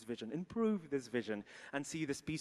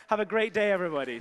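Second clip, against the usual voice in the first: laughing; 6 semitones higher, 11 dB louder, faster than usual. Real, not acted. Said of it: "laugh heard".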